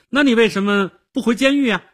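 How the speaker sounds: put-on high, loud, quick girl's voice; girl's retort. A narrator's reading. Girl throws Nick's complaint back at him, retorting.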